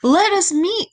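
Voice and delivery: high-pitched, overjoyed echo; joyful cry. A narrator's reading. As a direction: cry out with a leaping heart. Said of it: "cried Echo, her heart leaping with joy".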